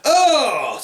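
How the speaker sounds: high, falling cry of disgust; mock-revolted wail; disgusted groan. Bear hearing about the toothbrush, revolted.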